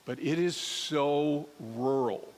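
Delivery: slow, stretched "it is so rural"; slow emphatic drawl on "so rural"; drawn out slowly. Shown as a demonstration. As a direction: lean into "so rural", dragging each word. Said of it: "I mean, it is so rural".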